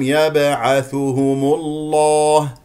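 slow recitation with a thick, dark L in الله; heavy L. Shown as a demonstration. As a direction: stretch الله with a heavy lam. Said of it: "فإذا تفخم اللام". (تفخيم اللام في الله)

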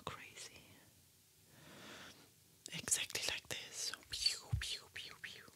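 soft whisper close to the mic; ASMR whisper. Acted. Whispering her makeup steps to ASMR viewers, soft whisper. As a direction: whisper gently, as if sitting right beside them.

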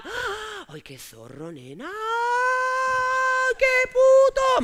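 squealing, hammed-up gossiping girls; mock shocked gossip. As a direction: camp mock outrage. Mock gossip girls about Juan. (chicas cotilleando escandalizadas sobre Juan)

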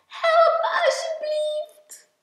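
high, loud, slow plea; crying out for help. A narrator's reading. Klein down in a hole, crying for help. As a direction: wail it up to your friend. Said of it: "riep hij".

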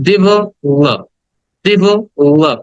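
ডেভেলপ split as "dive ... lup"; slow breakdown. Shown as a demonstration. Teacher breaks it down for students, split pronunciation. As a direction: pause inside ডেভেলপ, first vowel an i. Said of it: "কিছুটা ই এর মতো উচ্চারণ করবে".

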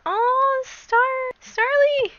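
way up high delighted call; excited high squeal. Real, not acted. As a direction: squeal the name with joy. Spotting a Starly.

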